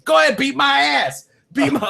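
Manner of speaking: high taunting dare; hammed-up mama bear; mock defiant mom. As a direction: dare them like a mom with a gun. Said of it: "And she's like".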